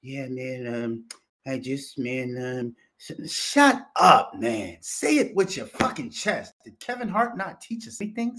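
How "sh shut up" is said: mock stammer.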